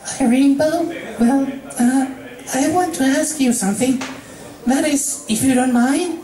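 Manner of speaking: high, hesitant pony voice; Fluttershy, shy request; timid Fluttershy. Acted. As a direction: ask apologetically.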